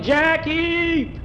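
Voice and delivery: high yell across the yard; yelling name. Acted. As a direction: yell his name, stretched out. Farmer always yelling for boy.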